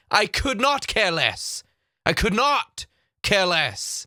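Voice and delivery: COULD NOT spelled out slowly, pitched high; emphatic correction. Shown as a demonstration. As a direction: hammer COULD NOT, slow and exasperated. Slow emphatic correction of misused phrase.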